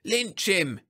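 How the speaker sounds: high-pitched mob-rousing cry; screamed. A narrator's reading. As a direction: cry out for a lynching. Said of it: "screamed the speaker".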